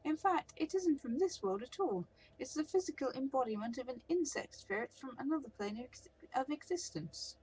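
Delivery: high, lilting elf voice, delighted; a flamboyant painted elf toying with the troll; theatrical elf. Acted.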